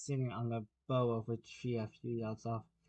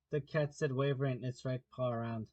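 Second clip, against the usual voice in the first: ordinary delivery, nothing marked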